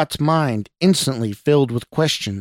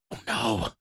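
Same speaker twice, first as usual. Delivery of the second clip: hushed, softer hiss; 7 dB quieter than usual. A narrator's reading. Scott appalled by stranger's confession, hissing. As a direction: hiss it under the breath. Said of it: "Scott hissed".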